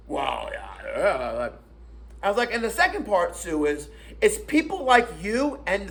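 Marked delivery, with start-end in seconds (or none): 0.1-1.5 low, soft mock muttering; imitated mumbling reaction. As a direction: mumble it under the breath, mimicking. Mimics her muttered reaction to insult.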